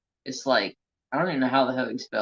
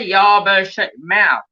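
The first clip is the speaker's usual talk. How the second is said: loud, raised-pitch retold comeback; mock bossy retort; 7 semitones higher, 10 dB louder than usual. Retelling a comeback to the boss, loud mock scolding. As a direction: fire it off loud, playing up the sass.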